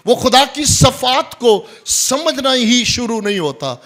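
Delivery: loud, high-pitched pulpit delivery; full-force preaching. Real, not acted. Preacher warns about love without relationship, loud and forceful. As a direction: hammer the point home from the pulpit.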